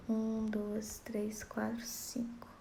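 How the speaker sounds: counting that sinks into a whisper; fading count. Shown as a demonstration. Teacher counts poetic syllables, fading to a whisper. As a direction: count softly until it is only breath. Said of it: "teacher counts syllables aloud".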